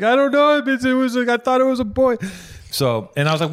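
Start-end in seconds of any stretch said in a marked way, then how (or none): 0.0-2.2 high, loud wailing imitation of the ex; mock crying. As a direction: exaggerate a tearful wail. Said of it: "she started crying".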